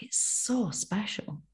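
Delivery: soft and slow, deeply felt; quiet, slow and heartfelt; tender. Real, not acted. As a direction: let it land gently. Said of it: "I just feel so honoured".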